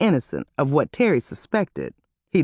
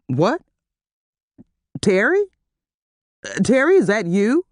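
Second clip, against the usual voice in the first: rising, higher alarmed questions; surprised and dismayed; 5 semitones higher than usual. A narrator's reading. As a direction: caught off guard, dreading it. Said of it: "he was surprised and disappointed".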